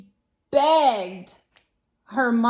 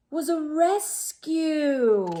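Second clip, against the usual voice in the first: usual speaking voice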